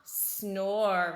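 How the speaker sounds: low, loud snoring; loud snore. A narrator's reading. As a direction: snore it out deep in sleep. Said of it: "replied Bear".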